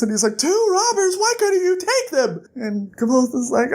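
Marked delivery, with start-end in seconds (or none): 0.4-2.4 very high shrieked disbelief; hammed-up astonished question; incredulous. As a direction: squeal it in mock outrage. Bast incredulous at Kvothe's defeat.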